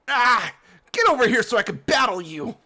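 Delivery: loud battle challenge shout. Acted. Hop challenges the villains, loud shout. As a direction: yell it out, spoiling for a fight.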